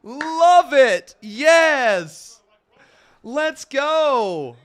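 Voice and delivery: loud, high hype shouts; hyped cheering. Real, not acted. Fan cheering on a favorite tag team, real hyped shouting. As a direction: whoop each short cheer at full volume.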